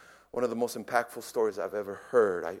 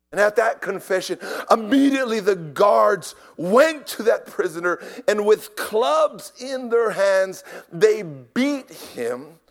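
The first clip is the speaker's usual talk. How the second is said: raised, urgent storytelling from the pulpit; impassioned preaching; 7 semitones higher, 7 dB louder, slower than usual. Real, not acted. Preacher telling of the guards counting tools, impassioned. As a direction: build the tension, high and loud.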